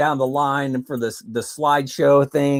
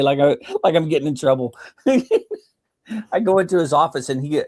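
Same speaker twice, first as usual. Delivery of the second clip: usual speaking voice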